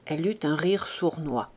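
ordinary delivery

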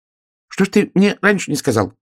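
high, hurt little voice; high-pitched reproach; offended hedgehog. A narrator's reading. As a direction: protest like a slighted small hedgehog. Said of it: "обиделся ёжик".

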